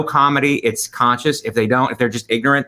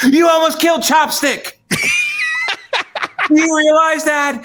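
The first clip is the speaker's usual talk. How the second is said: high shrieking yell; hammed-up outraged scream; mock scream. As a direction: scream it like a crazed bystander. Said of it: "you can scream".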